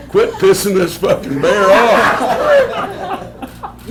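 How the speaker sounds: high, drawn-out mock protest; acted exasperated yell. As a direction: cry out slowly, as if under attack. Acted plea during the bear attack.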